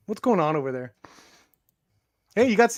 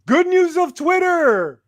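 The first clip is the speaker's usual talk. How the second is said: loud, raised announcer call; segment announcer; 9 semitones higher, 4 dB louder, slower than usual. Pretend. Host launching the good-news segment, announcer voice. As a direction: announce it big and drawn out.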